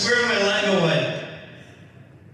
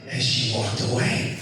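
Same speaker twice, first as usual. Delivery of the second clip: normal talking voice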